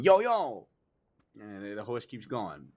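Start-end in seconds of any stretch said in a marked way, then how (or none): 0.0-0.7 high, loud acted yell; screaming. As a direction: yell at the horse that won't stop. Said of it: "like, screaming".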